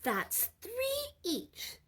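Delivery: put-on very high, slow child's wail with a laugh; dismayed child. A narrator's reading. Children see the cookies shrink, a very high, slow wail. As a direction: let the sum disappoint you.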